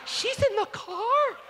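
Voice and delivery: high, slow, laughing shout of disbelief; shocked yell. Retold or imitated. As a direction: cry out in mock shock from the crowd. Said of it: "someone just yelled".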